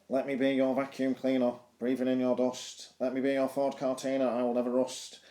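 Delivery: nasal Mancunian recital, pitch held level. Acted. Teacher quotes the punk poet's love lines, nasal Mancunian. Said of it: "in his nasal Mancunian accent".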